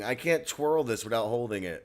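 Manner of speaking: normal talking voice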